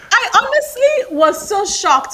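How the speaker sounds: normal talking voice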